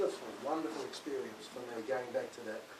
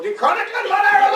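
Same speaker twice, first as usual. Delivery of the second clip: German title said in a playful, mock German accent, higher and louder; hammed-up German; 20 dB louder than usual. Shown as a demonstration. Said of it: "you've got to do it that way".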